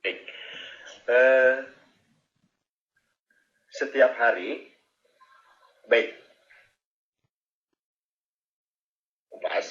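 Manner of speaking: choppy connection, cutting in and out; words dropping out over a bad line; glitching audio. Real, not acted. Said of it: "Bapak, suaranya putus-putus".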